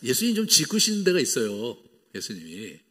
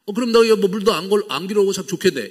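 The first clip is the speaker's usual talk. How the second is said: irritated mockery; 6 semitones higher, 7 dB louder than usual. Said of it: "이렇게 막 이렇게 화가 나갖고".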